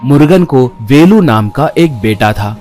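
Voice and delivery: plain reading voice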